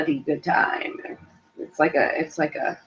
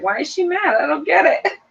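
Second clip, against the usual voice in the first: higher, puzzled voice; confused Daniel; 10 semitones higher than usual. Retold or imitated. Sheltered Daniel puzzled by British slang, higher confused voice. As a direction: play baffled and naive. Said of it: "Daniel's like".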